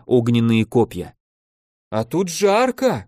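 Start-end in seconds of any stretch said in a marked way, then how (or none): 1.9-3.0 high, drawn-out call without much volume. A narrator's reading. Alexey dodging fiery spit in battle, calling out. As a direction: call it out over a fight. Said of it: "крикнул Алексей".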